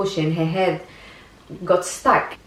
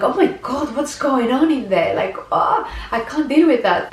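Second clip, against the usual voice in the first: squeamish panic; 6 semitones higher than usual. Retold or imitated. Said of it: "if my normal me would be like".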